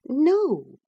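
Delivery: a bright, firm no; wife refusing husband's plan; Catherine, firm. Acted.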